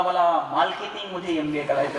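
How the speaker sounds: low-pitched imitation, "करायचं" said as "कलायचं" with L for R; imitated lisping classmate. Classmate answers the teacher about his MBA, R turned to L in "मालकेटिंग". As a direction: say every र as ल, as in "मालकेटिंग" for मार्केटिंग. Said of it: "त्या र ला ल म्हणायचं".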